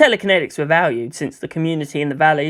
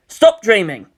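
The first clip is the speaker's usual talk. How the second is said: self-scolding snap; 4 semitones higher, 5 dB louder than usual. Acted. Shinji scolds himself.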